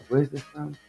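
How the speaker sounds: ordinary delivery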